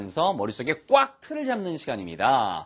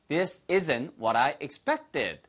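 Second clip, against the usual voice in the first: very slow, every word clear; this isn't what I ordered, drawn out for repeating; slow model sentence; much slower than usual. Shown as a demonstration. Teacher modelling the sentence slowly for learners. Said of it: "your turn".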